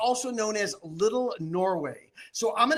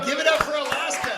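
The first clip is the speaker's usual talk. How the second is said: hype call; 5 semitones higher, 4 dB louder than usual. Real, not acted. Auctioneer hyping the online audience.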